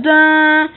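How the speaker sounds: loud mock computer boot dun; computer sound effect. Kid mimics the computer noise again. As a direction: voice the old computer chime.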